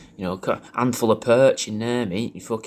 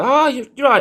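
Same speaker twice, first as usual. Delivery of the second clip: high, louder acted hello; eager greeting; 14 semitones higher, 6 dB louder than usual. Man greeting someone he recognises outside Home Bargains, eager. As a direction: greet them brightly, pitch jumping right up. Acting out greeting her in public.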